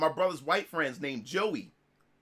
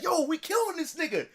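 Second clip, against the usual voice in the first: mock trash talk, pitched up; retold trash-talk yell; 9 semitones higher than usual. Gamer retelling trash talk to friends' rivals, mock yelling. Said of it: "we yell and talk trash".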